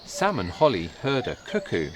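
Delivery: plain reading voice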